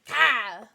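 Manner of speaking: Ka yelled as one loud syllable; Cartman's Kyle. Retold or imitated. Streamer calling the child's name, Cartman's loud Ka. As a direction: punch out Ka with no L. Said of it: "Not to be confused with Kyle".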